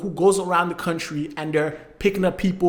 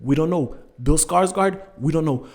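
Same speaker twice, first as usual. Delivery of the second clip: normal talking voice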